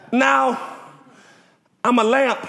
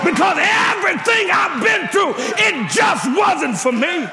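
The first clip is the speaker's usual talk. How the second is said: preaching climax; 5 semitones higher than usual. Acted. Pastor urging the church to praise, preaching at full force with a sung edge. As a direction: lift it into a singing whoop.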